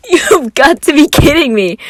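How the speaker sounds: very high laugh into a loud disbelieving cry; burst of laughter. A narrator's reading. Virgil hearing Roman's last name, laughing loudly. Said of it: "there any laughter left in his body".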